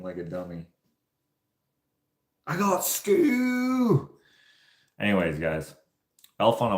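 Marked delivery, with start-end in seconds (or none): 2.4-4.1 very high, drawn-out SCHOOL in a mimicked accent; comic squeaky accent impression; put-on accent. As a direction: imitate the kid's accent, wailing the last word long and high. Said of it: "Dang, that accent was good".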